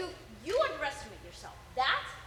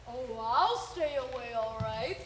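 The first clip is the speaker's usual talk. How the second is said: low villain drawl swelling louder; Sideshow Bob; 5 semitones lower, 6 dB louder, slower than usual. Acted. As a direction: start low, build through a long FOREVER. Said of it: "That's the line".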